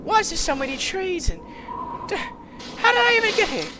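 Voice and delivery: ordinary talk, nothing marked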